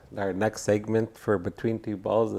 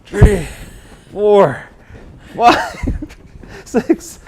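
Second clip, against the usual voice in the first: high and loud hype counting; pumped-up athlete; 9 semitones higher, 12 dB louder than usual. Acted. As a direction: count reps like an eager gym coach. Playing optimistic athlete, counting reps.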